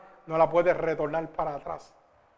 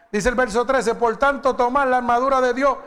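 loud, high, ringing scripture reading; full-force preaching; 7 semitones higher, 7 dB louder than usual. Acted. Pastor reads Ephesians to the congregation, loud preaching. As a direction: proclaim the verse to the whole church.

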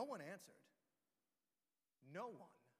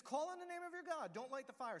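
high, loud mocking coach; Elijah taunting; 8 semitones higher, 6 dB louder than usual. Elijah taunting the prophets of Baal, high and loud. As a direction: egg them on, grinning. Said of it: "And he says".

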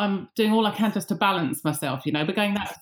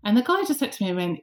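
ordinary talk, nothing marked

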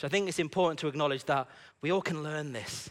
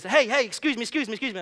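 higher, louder acted call after someone; urgent calling out; 7 semitones higher, 4 dB louder, faster than usual. Woman running after the man who prayed, calling urgently. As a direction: hail someone who is walking away.

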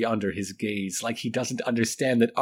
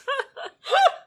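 high giggle. Real, not acted. Narrator breaks into laughter.

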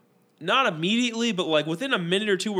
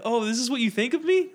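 higher-pitched, mildly indignant question; retold disbelief; 8 semitones higher than usual. Talker retelling his reaction to being misjudged, disbelieving. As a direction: lift it up in mock disbelief.

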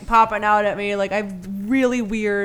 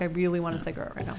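quick, much softer aside; muttering; 6 semitones lower, 13 dB quieter, faster than usual. Retold or imitated. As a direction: rush it under your breath. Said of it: "'cause I'm like".

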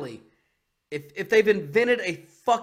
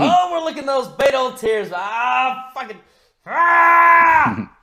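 gleeful shouting; 8 semitones higher, 11 dB louder, slower than usual. Real, not acted. Gloating over opponent's election loss.

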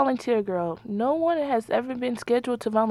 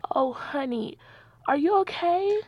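concerned; 5 semitones higher than usual. Acted. Nurse comforts heartbroken patient.

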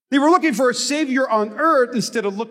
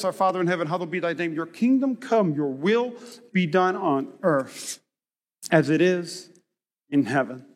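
reverent prayer; 5 semitones lower, 5 dB quieter than usual. Acted. Reciting the Lord's Prayer.

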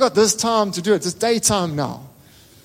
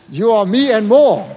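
louder, slow, proud declaration; emphatic father; 2 semitones higher, 7 dB louder than usual. Retold or imitated. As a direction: repeat it louder, driving the promise home. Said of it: "his dad just looks at him and says".

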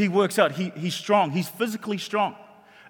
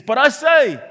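loud, raised declaration; scripture proclamation; 6 semitones higher, 10 dB louder, slower than usual. Real, not acted. Preacher quoting the words of Jesus to congregation, loud proclamation. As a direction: proclaim it with authority.

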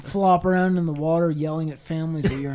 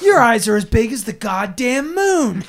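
loud teasing exclaim; 5 semitones higher, 7 dB louder than usual. Real, not acted.

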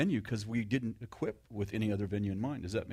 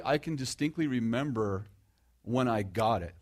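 ordinary talk

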